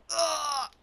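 sudden high, loud scream. Real, not acted. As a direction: shriek out in alarm. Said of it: "I'm screaming".

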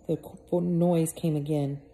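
ordinary delivery

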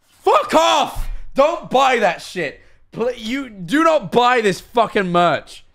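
loud, high, slowed-down fury; angry yelling. Real, not acted. Streamer warning chat off the merch, yelling angrily. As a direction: yell it as an outraged order. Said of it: "I'm so pissed".

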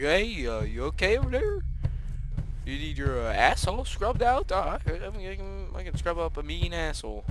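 goofy, hesitant demon with swooping pitch; hammed-up clueless monster voice; dopey demon. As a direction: play a bumbling demon, voice sliding up high. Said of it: "this sounds like a demon".

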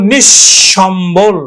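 নিঃসম্বল drawn out as nish-shombol; doubled palatal sh. Shown as a demonstration. Teacher saying how we pronounce it, stretched nish-shombol in নিঃসম্বল. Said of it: "আমরা উচ্চারণ করি কিন্তু".

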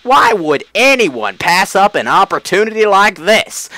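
high, loud menacing southern drawl; southern Flowey. Retold or imitated. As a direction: sneer it like a Southern villain flower. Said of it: "making Flowey sound southern".

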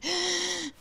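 a quick, high startled gasp; sharp gasp. Real, not acted. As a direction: catch your breath in fright. Sudden fright in the dark. (sursaut haletant)

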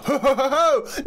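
loud high laugh bursting out; caught-out shrieking laugh; burst of high laughter. Real, not acted. Caught by the goose.

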